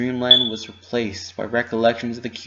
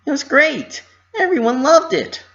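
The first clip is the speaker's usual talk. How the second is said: high, loud chirpy reply; 5 dB louder than usual. A narrator's reading. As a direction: chirp it back happily. Pinky answers happily.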